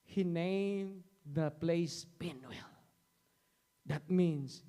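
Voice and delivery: ordinary talk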